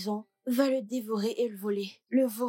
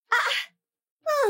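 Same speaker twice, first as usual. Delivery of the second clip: high, sharp squeal of joy; delighted squeal; 5 dB louder than usual. Acted. Girl counting her new money, squeals with delight.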